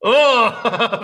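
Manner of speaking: high loud delighted oh; delighted gasp. Real, not acted. Delighted reaction to villain laugh.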